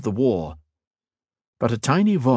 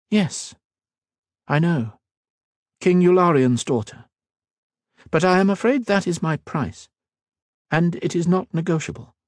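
a hint of a proud queen, raised a little; regal queen, lightly voiced; 9 semitones higher than usual. Queen questioning the mage, lightly voiced regal. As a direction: speak as a wary, commanding queen.

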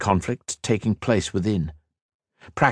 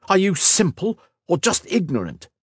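high-pitched, scornful demand; 11 semitones higher than usual. A narrator's reading. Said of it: "he demanded".

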